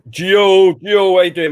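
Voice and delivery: calling out loud to a viewer; loud greeting. Real, not acted. Streamer loudly greets viewer Gio in chat.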